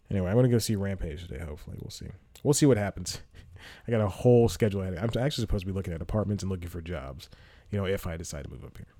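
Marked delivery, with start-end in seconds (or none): none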